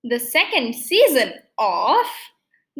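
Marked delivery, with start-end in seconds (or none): 2.8-2.8 slow, raised, ringing title call; podcast title announcement. Real, not acted. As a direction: announce the show name with a big stretched flourish. Announcing the podcast's name in intro.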